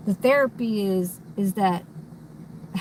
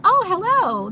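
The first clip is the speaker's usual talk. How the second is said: put-on high, bright-eyed Valley Girl greeting, much louder; 9 semitones higher, 5 dB louder than usual. Shown as a demonstration.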